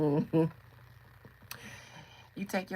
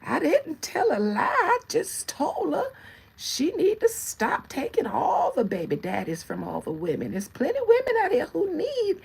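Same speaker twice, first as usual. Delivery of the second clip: put-on much higher, louder innocent old-lady squawk; shady old lady; 12 semitones higher, 5 dB louder than usual. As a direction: play dumb, loud and shrill-pitched.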